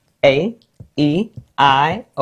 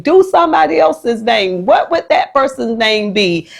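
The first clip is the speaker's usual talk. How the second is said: high-pitched eager request; excited pupils; 5 semitones higher than usual. Retold or imitated. Pupils asking teacher for another name, excited. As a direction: imitate children begging for another turn. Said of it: "that gets them excited about learning".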